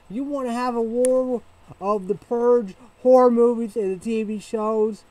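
high, loud yelling challenge; shouting rant. Real, not acted. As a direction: yell it as a dare at the enemy. Ranting challenge about South Park.

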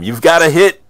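loud, high-pitched telling-off; exasperated in-character shout; scolding. Acted. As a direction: berate a teammate at the top of your voice. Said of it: "tisk tisk at Eric and tell him".

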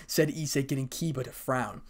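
plain reading voice

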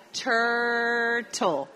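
Turtle stretched out in clear English, falling tune; slow English model word. Shown as a demonstration. Teacher repeating the word for students to hear, drawn out. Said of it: "¿Qué oyen?".

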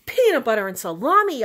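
loud high yelp. Acted. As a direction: yelp it out in alarm. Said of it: "I yelped".

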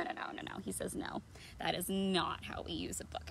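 soft whispered no. Acted. Reader to children, whispered reply. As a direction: hush it, telling them no.

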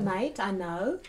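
light attempt at an Australian accent. Shown as a demonstration. Host showing the guest an accent on request, light Australian accent. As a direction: try a casual Aussie accent. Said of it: "Can you do an Australian accent?".

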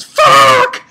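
loud, very high drawn-out outcry; mock agonized yell. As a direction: scream it as a hammed-up reaction to awful food. Acts out character's cry after eating.

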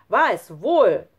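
very loud, slow insistence; angry scolding. A narrator's reading. Lukas argues back at Schlunz, angry. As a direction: contradict him forcefully, word by word. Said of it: "schimpfte Lukas".

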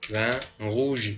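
و روژ stretched slowly; slow French word. Shown as a demonstration. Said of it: "شراب قرمز". (و روژ را کشیده بگو)

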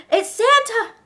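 high, excited exclamation; overjoyed. Acted. Girl seeing Santa come to rescue, overjoyed. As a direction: cry it out with relief.

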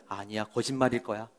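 soft, muttered insistence; quiet denial. Acted. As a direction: tell yourself it is a lie, under your breath. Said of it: "감추고 싶었어".

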